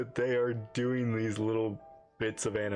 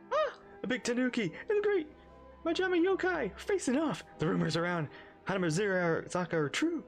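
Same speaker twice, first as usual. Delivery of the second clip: high, awed excited voice; excited gasp; 10 semitones higher than usual. Pretend. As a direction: gasp and gush in awe. Awed reading of excited character.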